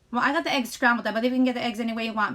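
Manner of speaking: ordinary talk, nothing marked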